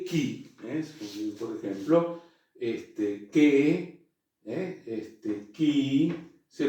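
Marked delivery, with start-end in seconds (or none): 0.0-0.6 ki with a strong k; hard k sound. Shown as a demonstration. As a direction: spit out a sharp ki. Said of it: "tiene un sonido fuerte". (ki con sonido fuerte de k)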